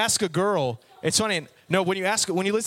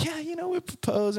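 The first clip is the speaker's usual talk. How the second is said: giddy; 11 semitones higher, 3 dB quieter than usual. Retold or imitated. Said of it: "I'm just like".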